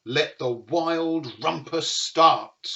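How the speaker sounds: loud, slow, grand announcement; Max crying out. Acted. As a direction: proclaim the party open. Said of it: "cried Max".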